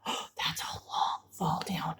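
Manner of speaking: soft worried whisper; hushed mock fear. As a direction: whisper it fearfully. Worried aside at the cliff.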